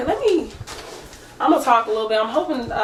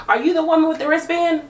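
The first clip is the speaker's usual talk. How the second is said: mock attitude; 6 semitones higher than usual. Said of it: "She has an attitude".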